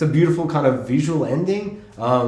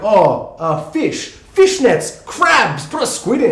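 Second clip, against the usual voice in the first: high, punchy tossed-out suggestions; mock brainstorming, rising in excitement; someone listing ideas; 6 semitones higher, 4 dB louder, much slower than usual. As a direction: call out each idea as it strikes. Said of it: "looks like someone who's like".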